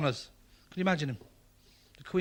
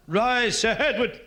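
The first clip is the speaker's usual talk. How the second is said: louder and higher, a royal command at full volume; commanding call; 7 semitones higher, 7 dB louder, slower than usual. Retold or imitated. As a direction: bellow it like the Queen losing patience.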